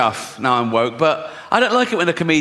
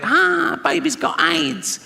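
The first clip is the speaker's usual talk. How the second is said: high, slow mocking squeak; 13 semitones higher, much slower than usual. Comedian mimicking the show's line in a mock high squeak.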